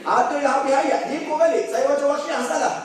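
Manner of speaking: high, loud, animated storytelling; high-pitched excited recounting; excited retelling. Retold or imitated. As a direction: tell it like the fight is happening now. Excited retelling of the jinn fight.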